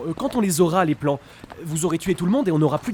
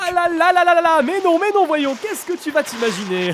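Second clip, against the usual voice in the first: flustered denial; 10 semitones higher, 3 dB louder than usual. Acted. Henchman nervously covering a secret.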